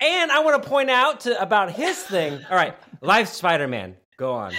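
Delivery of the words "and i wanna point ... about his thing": little brother voice. Retold or imitated. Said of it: "your little brother being like".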